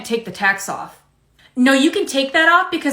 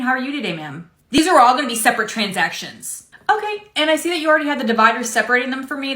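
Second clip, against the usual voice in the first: normal talking voice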